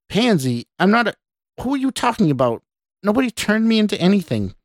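high, fast, agitated comeback; indignant protest. A narrator's reading. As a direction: protest in a rising fluster. Scott protests the stranger's insult.

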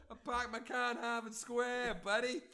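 put-on high, slow Boston accent with a laugh; hammed-up Boston accent. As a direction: lean hard into a mock Boston accent. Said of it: "the Boston accent".

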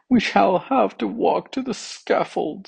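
high-pitched voice of the feet; worried feet. A narrator's reading. Feet foresee walking to the scaffold, worried.

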